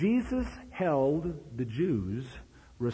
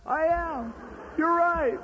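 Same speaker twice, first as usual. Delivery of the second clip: tearful confession. Retold or imitated. Linda giving in to the preacher, high and weepy. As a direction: give in through tears. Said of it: "bawling like a baby".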